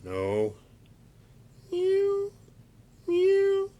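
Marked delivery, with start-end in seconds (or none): 1.7-3.7 very high, louder squeaky meow; a monkey puppet pretending to be a cat. Fred the puppet meows instead of monkey sounds, very high squeak. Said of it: "that's not the sound monkeys make".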